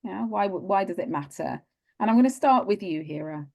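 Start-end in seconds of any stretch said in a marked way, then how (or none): none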